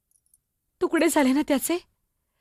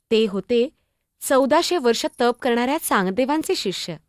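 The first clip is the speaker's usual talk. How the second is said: calm, lower storytelling voice, looking back; story narration; 4 semitones lower than usual. A narrator's reading. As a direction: tell it gently as a memory. (आठवण सांगणारे शांत निवेदन)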